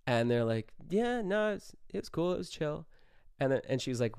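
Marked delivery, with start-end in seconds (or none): 0.9-2.9 high, breezy fake casual voice; fake innocence. Friends lying to the manager, high breezy fake calm.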